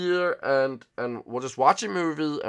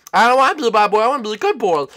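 put-on high, loud childish pleading; whining little boy; 11 semitones higher, 13 dB louder, faster than usual. Boy pleads after scolding.

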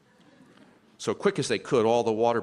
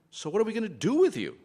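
high lobster voice; 12 semitones higher, 4 dB louder than usual. A narrator's reading. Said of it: "said the lobsters".

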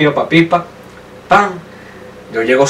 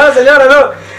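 high, flustered plea in a retelling; acted-out nervous protest, pitch jumped up; nervous refusal; 9 semitones higher than usual. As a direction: fend her off in mock panic. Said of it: "me da un nervio".

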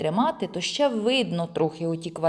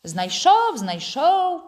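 high, loud, drawn-out parroting; mocking mimicry; 9 semitones higher, 6 dB louder, much slower than usual. A narrator's reading. Friend mimicking the boy's question, mockingly. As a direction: parrot the question back to taunt. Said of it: "перекривляє він".

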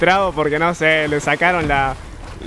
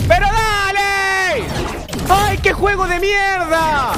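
furious yelling; 12 semitones higher, slower than usual. Real, not acted. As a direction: blow up at the game. Raging at the game.

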